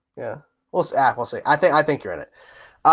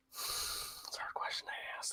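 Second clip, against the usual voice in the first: whisper; 23 dB quieter, slower than usual. Real, not acted. Hard question, said under breath.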